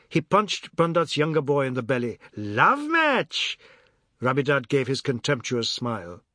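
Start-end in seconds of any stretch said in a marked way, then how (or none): 2.4-3.6 much higher gleeful shriek, less a question; mocking shriek. A narrator's reading. Ajoda repeating it to mock Biswas, high shriek. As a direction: shriek the phrase back with relish. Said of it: "Ajoda's chuckles and shrieks".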